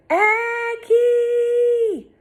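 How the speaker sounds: long high call of a name from afar; distant call. A narrator's reading. Rescuers calling for the lost girl, distant shout. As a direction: call out across the beach. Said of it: "in more than one voice".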